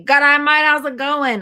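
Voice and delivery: high, loud bogan greeting in Australian slang. Shown as a demonstration. Showing viewers how a bogan greets a mate, loud bogan accent. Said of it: "I'm speaking like a bogan".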